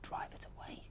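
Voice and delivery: soft unvoiced whisper; whispered to himself. Tom muses over Lizzie's words, whispering. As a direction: mull it over under your breath. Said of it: "he repeated slowly to himself".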